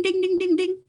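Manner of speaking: high, ringing ding ding ding like a newsroom bell; sing-song bell ding imitation; imitated bell dings. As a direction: ring it out like a bulletin bell. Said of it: "announced in the loud, busy newsroom by a ding".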